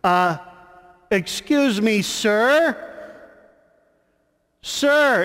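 calling out loudly and firmly, pitched high; calm but loud. Retold or imitated. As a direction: call across the water, polite but firm. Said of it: "but yet loudly and assertive".

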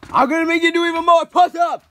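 loud, high furious threat; angry yelling. Acted. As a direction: shout the punishment at him. Angry sergeant punishes kid.

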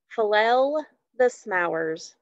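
Flell the smowers said slowly, first sounds swapped; spoonerism. Shown as a demonstration.

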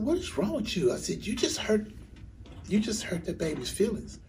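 high-pitched, imitated tearful protest; tearful confrontation. Crying uncle or aunt scolds mom over niece, tearful outrage. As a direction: act out a worked-up scolding through tears. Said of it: "and I was crying".

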